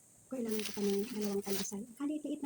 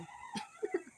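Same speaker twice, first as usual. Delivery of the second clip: very high squeal of laughter; short squeaky giggle. Real, not acted. Laughing about bus fare.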